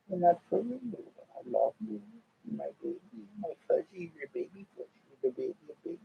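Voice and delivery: hushed, tender cooing to a cat. Real, not acted. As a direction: coo it lovingly, barely above a breath.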